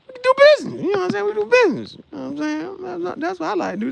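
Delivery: mock hustler pitch. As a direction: squeak it out like a grinning dealmaker.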